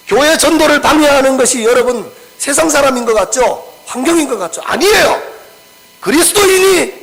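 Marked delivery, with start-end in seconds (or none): 4.6-6.9 much higher and louder; emphatic preaching. Real, not acted. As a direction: drive the point home to the congregation. Preacher stresses a key point. (설교자가 회중에게 크고 높게 힘주어 강조)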